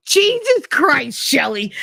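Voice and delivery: imitating the principal's outraged yell, high and drawn out; slow, high-pitched mock holler, dropping on the name; retold yell. Principal catching a teacher groping at the bar, mock yelling. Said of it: "Why are you yelling at me?".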